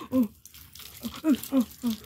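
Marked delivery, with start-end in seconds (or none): none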